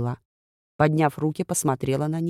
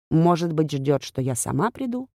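ordinary delivery, nothing marked

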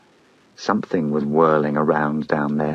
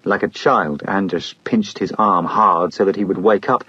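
usual speaking voice

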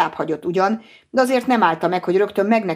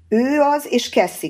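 higher-pitched, understated call to the others; narrated shout without much added volume; 8 semitones higher, slower than usual. A narrator's reading. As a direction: call the news out, pitched up at the start. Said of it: "kiáltotta a többieknek".

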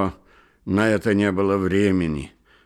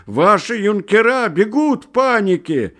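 high, loud alarmed cry; urgent shout; 10 semitones higher, 6 dB louder than usual. Acted. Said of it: "закричал ему навстречу".

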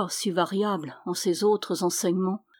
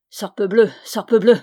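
loud curse, louder on the repeat; exasperated oath; 9 dB louder than usual. A narrator's reading. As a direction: punch out the doubled oath. Knight curses at another desertion.

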